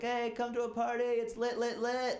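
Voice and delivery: high, hyped party invite; excited cousin. Retold or imitated. Cousin texting a party invite, high and hyped. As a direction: pitch it up like an eager college kid. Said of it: "He texts me, he's like".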